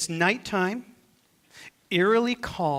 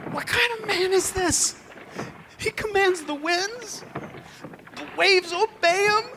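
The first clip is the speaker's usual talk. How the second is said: much higher, amazed voice; awestruck disbelief; 11 semitones higher than usual. Acted. Disciples marveling at Jesus in the boat, awestruck. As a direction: marvel aloud as if you can't believe it. Awed disciples after the calm.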